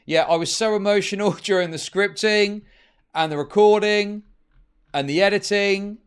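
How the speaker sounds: mocking impression. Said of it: "He's like".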